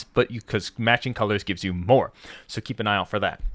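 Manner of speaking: ordinary talk, nothing marked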